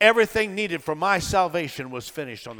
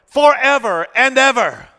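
high, loud preacher's climax; triumphant proclamation; 8 semitones higher, 8 dB louder than usual. Real, not acted. Preacher proclaiming his mother lives on, triumphant proclamation. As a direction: ring it out in triumph. Repeats the phrase, swelling to proclamation.

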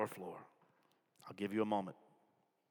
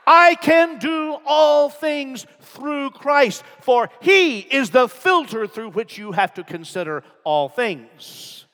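high, loud preaching; fiery pastor at full volume; preaching at full force; 11 semitones higher, 19 dB louder than usual. Acted. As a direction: drive it home to the congregation.

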